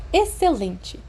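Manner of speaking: bright, pleased "Excelente!"; beaming teacher, mild delight; pleased exclamation. Acted. Professor pleased with his choice, mild delight. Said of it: "McGonagall sorriu amplamente".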